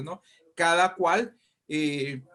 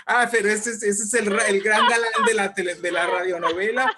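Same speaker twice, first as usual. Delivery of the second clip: louder, higher let-down exclamation breaking into a laugh; mock disappointment; 4 semitones higher, 6 dB louder, faster than usual. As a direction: imitate a fan let down by the star, laughing. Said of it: "Porque decían".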